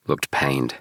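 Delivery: ordinary delivery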